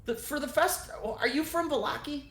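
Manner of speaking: high, puzzled questioning; rattled homeowner's high voice; flustered older woman. Acted. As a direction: pitch it high, confused and probing. Game master plays the older woman.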